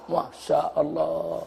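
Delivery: slow, soft, head-shaking sigh of dismay; decak heran. Real, not acted. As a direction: breathe it out, slowly and softly, in disbelief. Exclaims after quoting a lazy complaint. (pelan dan lirih, geleng-geleng heran)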